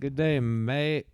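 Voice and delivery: slow, half-hearted Australian attempt; attempted Australian accent. Trying Australian after saying he can't, weak accent. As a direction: badly fake an Australian greeting.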